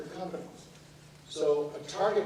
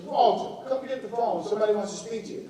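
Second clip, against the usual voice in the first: high, raised call across the house; retold aunt summoning Walter; 7 semitones higher, 5 dB louder than usual. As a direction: call him to the phone, pitched up. Aunt calling uncle again.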